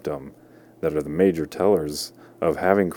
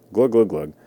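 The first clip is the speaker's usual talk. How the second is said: imitated gulping; 3 semitones higher, 4 dB louder than usual.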